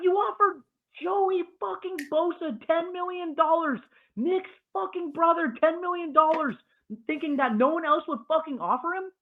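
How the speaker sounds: high, strained outraged yelling; furious rant. Real, not acted. Podcaster raging at his team's front office, high furious rant. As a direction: let the disbelief boil over. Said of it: "That's the end of my crash out".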